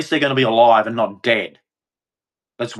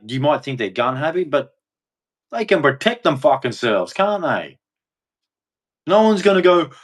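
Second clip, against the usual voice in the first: ordinary delivery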